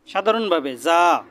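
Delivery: normal talking voice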